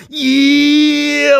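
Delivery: long, high "yeeeah"; stretched, high-pitched celebration; hyped drawn-out cheer. Pretend. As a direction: hold the word out in a sustained hype holler.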